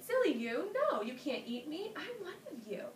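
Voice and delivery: high-pitched, playful mock scolding; wheedling spider. A narrator's reading. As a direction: talk the crocodiles out of it in a high voice. Spider fools the hungry crocodiles.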